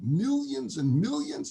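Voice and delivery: high, slow, amazed MILLIONS and MILLIONS; excited kid. Acted. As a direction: echo it in thrilled wonder. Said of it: "He's all excited".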